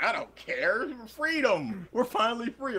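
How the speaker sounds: high, airy mock freedom cry; mock carefree Russian. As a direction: fling it out like a liberated hippie. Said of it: "they're just like".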